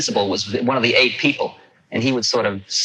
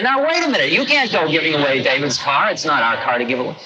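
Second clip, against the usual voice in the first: alarmed protest; 4 semitones higher than usual. Retold or imitated. Protesting the car gift.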